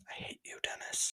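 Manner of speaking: very quiet, voiceless whisper; whispered aside. Acted. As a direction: whisper it under your breath as a parting dig. Muttered aside after signing off.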